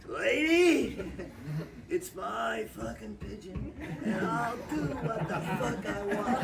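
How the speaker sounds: loud, high mock outburst through laughter; hammed-up angry yell at the lady; Frank snapping back. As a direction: Frank yelling back at the old lady over his pigeon, laughing. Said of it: "And Frank goes".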